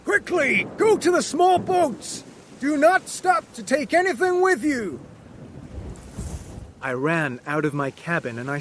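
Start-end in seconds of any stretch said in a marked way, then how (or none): 0.0-5.0 captain shouting. Acted. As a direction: bark the order to abandon ship. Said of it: "the captain shouted to everyone".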